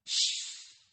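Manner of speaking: sharp hushing hiss; urgent whispered shush to silence a friend. A narrator's reading. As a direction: hush them with a whispered shh. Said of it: "disse Athos a bassa voce".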